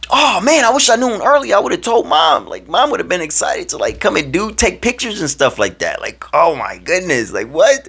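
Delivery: high, loud, thrilled regret; excited outburst. Retold or imitated. Player wishing he had told Mom, excited and disbelieving. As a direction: gush in high-pitched excitement. Reacts to parents coming for pictures.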